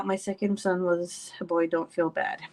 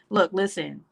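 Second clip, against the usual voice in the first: usual speaking voice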